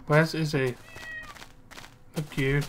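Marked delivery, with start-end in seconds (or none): none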